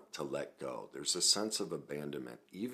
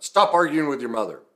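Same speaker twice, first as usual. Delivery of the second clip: acted parental yell, high and loud; loud retold scolding; angry father; 11 semitones higher, 16 dB louder, faster than usual. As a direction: imitate a dad shouting a reprimand.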